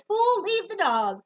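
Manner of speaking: high, loud, slow scolding from a driver; gruff stranger. Acted. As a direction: bark at the boy to leave the dog. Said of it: "a man in a straw cowboy hat says".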